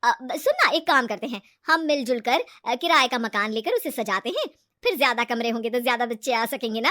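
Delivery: loud, persuasive pitch; lightly acted friend selling a joint plan; scheming friend Ritu. Ritu proposing a shared rented house, persuasive.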